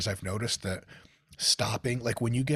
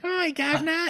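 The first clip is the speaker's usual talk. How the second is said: squeaky, very high mock British urchin; chimney sweep kid; 3 dB louder than usual. American's idea of a British person, child chimney sweep voice. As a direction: play a little 1920s British chimney sweep. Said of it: "nineteen twenties five-year-old chimney sweep kid".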